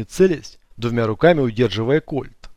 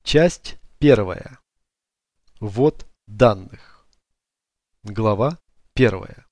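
slow formal heading read; chapter announcement; 2 semitones lower, much slower than usual. A narrator's reading.

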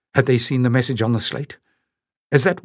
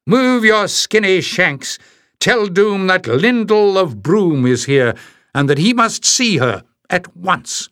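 roared insult; 7 semitones higher than usual. Acted. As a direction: sneer it out at full volume. Giant woman barking orders.

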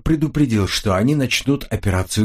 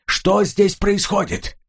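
calling out, much higher and slow; shouted; 10 semitones higher, slower than usual. Acted. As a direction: shout the question over the noise. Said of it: "он крикнул".

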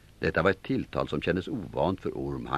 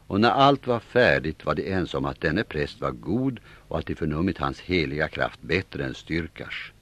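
ordinary delivery

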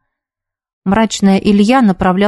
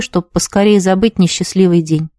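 usual speaking voice

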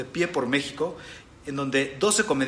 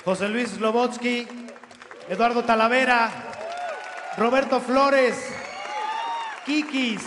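stage announcer; 9 semitones higher than usual. Acted. Host presenting comedians on stage.

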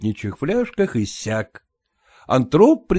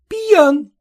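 dismayed; 14 semitones higher, 4 dB louder than usual. A narrator's reading. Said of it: "всплеснула руками".

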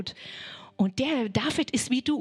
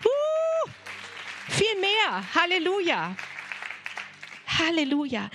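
ecstatic cry; 7 semitones higher than usual. Real, not acted. Preacher's ecstatic exclamation.

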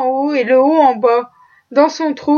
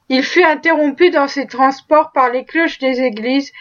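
plain reading voice

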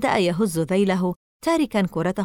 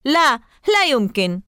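put-on a loud, high boy's outcry; shouting; 6 semitones higher, 5 dB louder than usual. A narrator's reading. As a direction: cry out against it. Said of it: "صرخت". (صرخة احتجاج)

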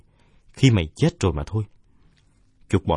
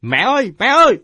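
high, loud cry of pain, rising to a shriek; screaming for mom; 9 dB louder than usual. A narrator's reading. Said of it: "Tôi hét lên".

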